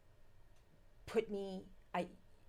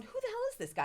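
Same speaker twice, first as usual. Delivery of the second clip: much higher, startled question; alarmed thought; faster than usual. Retold or imitated. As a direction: blurt it in sudden alarm.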